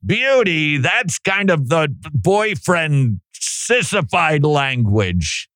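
high, drawn-out mock alarm; mock-scandalized, sliding down from high; objecting conservative type. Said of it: "as a conservative sola scriptura type, go".

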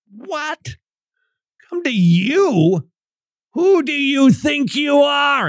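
high-pitched, drawn-out astonishment; incredulous. Acted. Listener reacting to "Come to me", high incredulous challenge. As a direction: challenge the speaker in disbelief. Acts out outraged listener's disbelief.

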